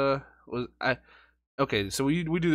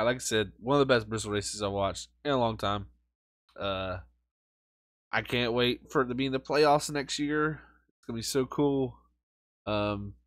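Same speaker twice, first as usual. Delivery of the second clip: ordinary delivery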